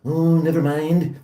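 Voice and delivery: slow dismissive cartoon boss; Mr. Burns. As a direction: wave it away as the old boss, slowly. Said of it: "he's talking about".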